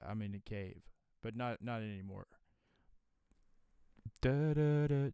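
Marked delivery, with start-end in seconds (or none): 4.2-5.1 compressed mic test; sung test syllables, compressed; heavily compressed. Shown as a demonstration. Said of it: "now it should sound normal".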